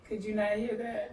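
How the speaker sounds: ordinary talk, nothing marked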